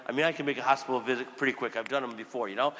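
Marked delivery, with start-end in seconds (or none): none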